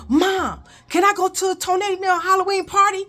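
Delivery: loud, high call down the hall. Retold or imitated. Kid calls out to mom on the phone, high and loud. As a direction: blurt it out fast and run.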